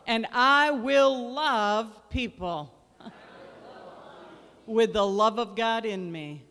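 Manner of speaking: loud, slow, ringing proclamation; declaring with conviction. Shown as a demonstration. Pastor leading the church in a loud faith declaration. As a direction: preach each line to the rafters. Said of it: "say it like you mean it".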